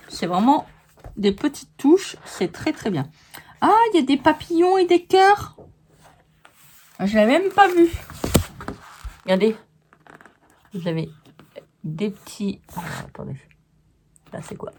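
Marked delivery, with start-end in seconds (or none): 3.6-7.9 delighted surprise. Real, not acted. Spotting hidden butterflies and hearts. 12.8-14.7 hushed to herself. Real, not acted. Peering closely at the paper.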